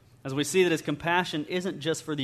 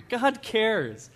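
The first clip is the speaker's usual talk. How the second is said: ordinary delivery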